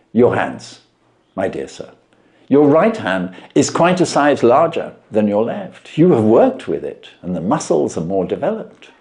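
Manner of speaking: measured, knowing detective voice; Holmes voiced. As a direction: lay out the deductions with calm assurance.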